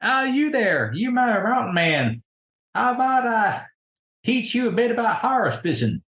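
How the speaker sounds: high, swooping country-lady voice; folksy country lady. Acted. Country lady offers the mountain man a lesson, high and folksy. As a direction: call him over in a high, folksy voice. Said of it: "And I do declare says".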